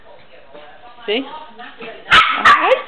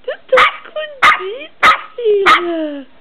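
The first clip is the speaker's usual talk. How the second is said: much higher and louder sing-song; 7 semitones higher, 5 dB louder than usual. Real, not acted. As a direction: squeal the question up high like a game. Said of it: "same words asked again in a new voice".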